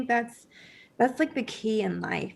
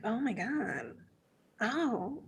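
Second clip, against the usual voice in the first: soft realization; 8 dB quieter, slower than usual. Retold or imitated. Realization retold softly.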